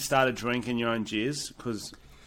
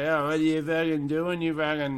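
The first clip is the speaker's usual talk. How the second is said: put-on posh Australian accent; 2 semitones higher, 4 dB quieter than usual. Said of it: "posh Australian who's like".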